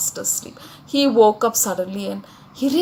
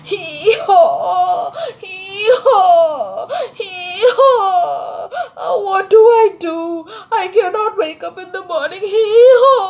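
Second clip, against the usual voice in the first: high, loud braying wail; crying donkey; 8 semitones higher, 7 dB louder than usual. A narrator's reading. Donkey despairs to himself about waking up, crying. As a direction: sob in long hee-haws, pitched way up. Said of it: "He started crying like this".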